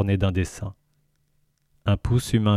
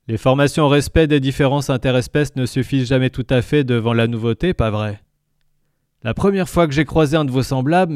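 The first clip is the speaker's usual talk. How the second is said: higher, louder creature voice; gurgling tentacle alien; 5 semitones higher, 3 dB louder than usual. Acted. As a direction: speak as the jovial alien. Lintel alien voice.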